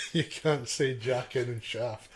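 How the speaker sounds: real laughter breaking into the words; laughing. Real, not acted. Co-host ribbing his friend's accidental innuendo, laughing. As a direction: tease through a laugh, slowly.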